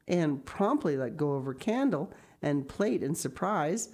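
plain reading voice

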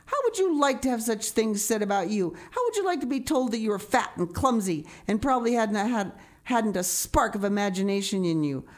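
put-on wounded child's furious rant; vehement. A narrator's reading. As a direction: pour out the hurt and anger vehemently. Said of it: "she repeated vehemently".

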